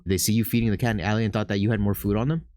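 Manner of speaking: ordinary delivery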